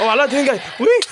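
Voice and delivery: high, loud real alarm; real startled shout at the dragon; alarmed warning. Real, not acted. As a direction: yell the dragon is coming. Dragon arriving mid-interview.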